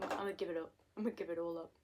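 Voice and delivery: normal talking voice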